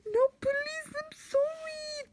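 very high squealed begging; hammed-up high wail, drawn out at the end; mock pleading. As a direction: beg in a squeaky put-on voice. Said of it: "Then he's like".

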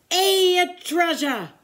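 high, loud pirate cheer; crew shout. A narrator's reading. Pirate crew cheers about treasure: loud high shout. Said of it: "the others shouted".

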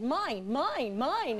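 mock child claiming mine; lightly voiced greedy toddler. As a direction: voice a toddler grabbing. Twins grabbing books.

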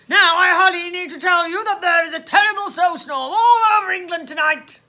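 booming, officious police voice; lecturing policeman. Acted. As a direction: declaim it down the phone.

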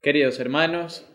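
usual speaking voice

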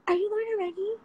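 high, starstruck fan voice, asked in wonder. Retold or imitated. Fan meeting her in person, high and starstruck. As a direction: play the fan who just recognized her. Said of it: "is like".

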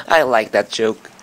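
ordinary talk

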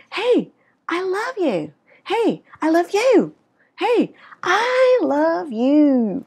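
high, drawn-out, gushing "I love you"; full of feeling. Shown as a demonstration. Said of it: "để cảm xúc của mình vào". (đầy cảm xúc, ngân dài)

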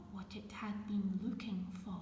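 hushed storytelling whisper; whispered reading. Acted. Reading the firefly story to children in a whisper. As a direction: breathe the words out, no voice.

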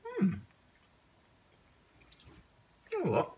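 chewing while talking; words muffled by food; mouth full. Real, not acted. Seen 0: Took food at -12; lips pursed, jaw working while speaking.

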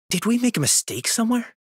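high-pitched, troubled question; worried question. Acted. Character reacts to a lost battle.

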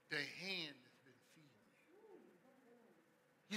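softly reproachful aside; sudden hush. Acted. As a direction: drop to a near hush. Sudden soft aside in rant.

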